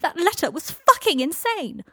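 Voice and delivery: loud, high yell near breaking; furious yell, voice cracking. A narrator's reading. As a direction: scream it, voice about to crack. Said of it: "yelled, voice almost cracking".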